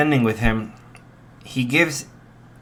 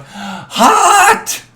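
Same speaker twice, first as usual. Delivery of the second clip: much higher, louder sudden cry; pained yelp; 8 dB louder than usual. Retold or imitated. Izuku burning his mouth on the fireball, high loud yelp. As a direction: yelp as the fire burns the mouth. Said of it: "he goes".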